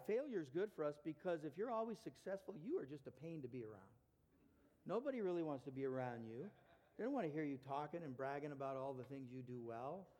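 ordinary talk, nothing marked

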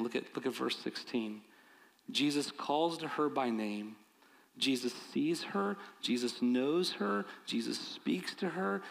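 ordinary talk, nothing marked

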